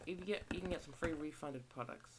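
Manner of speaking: ordinary talk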